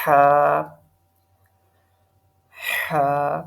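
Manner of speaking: ہا pushed hard and loud from the throat; loud model of ہا; forceful throat ha. Shown as a demonstration. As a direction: strain ہا out despite a sore throat. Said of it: "میرا تھوڑا گلا خراب ہے".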